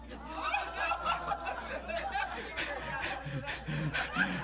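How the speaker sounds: breathy, wheezing laugh. Real, not acted. Preacher laughs at his own story, long wheezy laugh. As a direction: let a long laugh take over.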